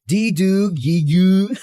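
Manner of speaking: teasing mock baby babble; baby talk. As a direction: babble like a baby, breaking into a laugh. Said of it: "Sounds like a baby trying to talk".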